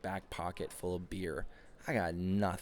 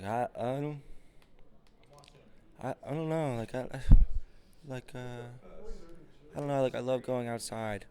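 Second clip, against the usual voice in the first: halting, higher, mumbling mock date; mock shy date; 4 semitones higher, 4 dB louder than usual. Mocking a date who can't name a hobby, timid and hesitant. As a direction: play the tongue-tied date.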